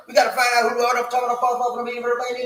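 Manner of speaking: loud, rushed announcement; too fast. Real, not acted. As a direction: rattle it off loudly. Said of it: "you need to say that slow".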